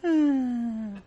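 high, soft happy hum; a pleased little piglet hum; mock touched whimper, high; pig's content hum. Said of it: "And pig goes".

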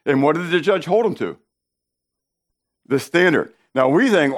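ordinary talk, nothing marked

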